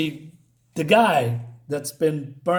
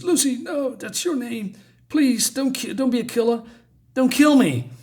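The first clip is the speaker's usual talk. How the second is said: high, desperate begging, much higher pitch; pleading; 9 semitones higher than usual. Acted. Burning man begs Lucy not to kill him, high and frightened. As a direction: beg for your life in a high, frightened voice.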